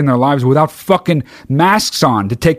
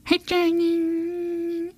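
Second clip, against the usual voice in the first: put-on very high, soft baby voice; baby talk to dog; 9 dB quieter than usual. Cooing to his dog Jane so she knows he's not mad, in a very high soft voice. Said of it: "And I'm just like".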